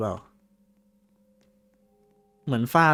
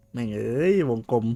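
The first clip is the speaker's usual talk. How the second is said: ordinary talk, nothing marked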